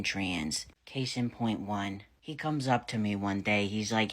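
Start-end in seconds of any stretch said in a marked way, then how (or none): none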